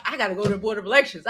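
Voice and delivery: rattled off fast; rushed. Retold or imitated. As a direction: hurry through it fast. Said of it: "I'm like".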